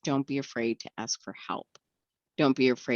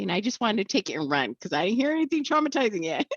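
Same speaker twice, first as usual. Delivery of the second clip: ordinary talk, nothing marked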